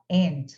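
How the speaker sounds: aunt said like "ant", short flat a; aunt, clipped and flat like the insect ant; American aunt. Shown as a demonstration. Teacher shows students the American aunt, said like ant. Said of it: "no inglês americano normalmente falam".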